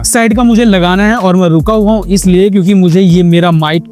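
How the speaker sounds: normal talking voice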